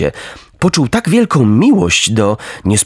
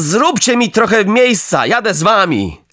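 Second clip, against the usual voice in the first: put-on higher, firm boyish voice; raised, resolute puppet voice; earnest puppet Pinokio; 9 semitones higher than usual. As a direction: stand your ground like a good boy.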